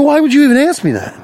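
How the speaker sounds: high-pitched, incredulous comeback; exasperated. Retold or imitated. Customer to man with a silly question, exasperated. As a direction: demand an answer in high exasperation.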